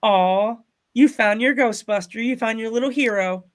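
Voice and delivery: high, melting mom voice; cooing mom. Retold or imitated. Mom to little girl hugging her hero, high imitated cooing. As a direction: imitate a mother gushing over her child. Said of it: "And the mom's like".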